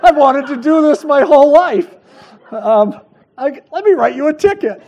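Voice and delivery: loud and high, laughing through a pretend traffic stop; laughing mock cop. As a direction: ham up the daydream of writing a ticket, laughing. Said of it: "I thought".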